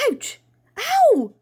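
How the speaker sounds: short ouch, then high, loud ow; sharp cry of pain; yelp of pain. Acted. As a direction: yelp as if just pecked. Dad pecked by the struggling crow.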